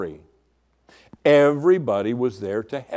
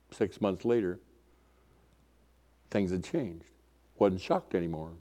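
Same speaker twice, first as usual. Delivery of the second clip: subdued regret; 4 semitones lower, 7 dB quieter than usual. Real, not acted. Sad admission of growing used.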